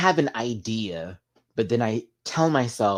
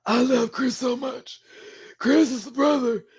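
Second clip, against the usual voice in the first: imitating the brother Chris, pitched way up; Chris impression; 4 dB louder than usual. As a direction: slip into Chris's high sing-song voice. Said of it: "He talks like this".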